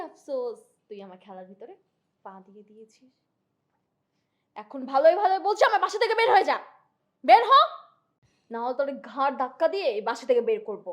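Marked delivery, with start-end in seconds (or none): 4.6-7.5 high, loud angry order; angry shout. Acted. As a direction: throw him out of the house. Woman throws him out.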